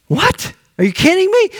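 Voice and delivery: can't believe he's stopping now; incredulous outburst. Acted. Jairus disbelieving as Jesus stops in the crowd, high incredulous cry.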